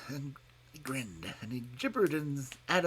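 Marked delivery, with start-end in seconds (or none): none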